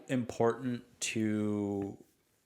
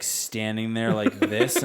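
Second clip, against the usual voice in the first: ordinary talk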